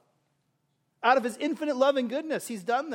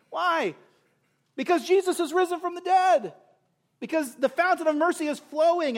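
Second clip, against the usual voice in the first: preaching at full force; 4 semitones higher than usual. Acted. Preacher urging unbelievers to the mercy seat, full-force preaching.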